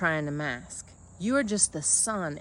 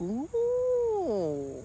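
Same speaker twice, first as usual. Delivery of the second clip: high ooh; 11 semitones higher than usual. Real, not acted. Reacting to own point.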